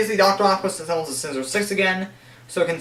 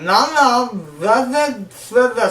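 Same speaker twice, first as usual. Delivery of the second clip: higher, louder garbled syllables with a burnt tongue; burnt-tongue talk; 5 semitones higher, 3 dB louder, slower than usual. Retold or imitated. Spider-Man taunting Electro with a burnt tongue, garbled. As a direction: babble as if your tongue is scalded. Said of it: "he'll be talking like this".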